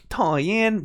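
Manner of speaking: Toyen said high, then falling; mock Swiper's whine; retold Toyen. Retelling to viewers what she blurted out, a mock Swiper whine. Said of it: "but I went".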